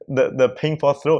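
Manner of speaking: usual speaking voice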